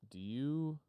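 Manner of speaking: quoting the WarGames computer. Retold or imitated. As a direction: ask it like the movie computer. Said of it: "Oh, war games".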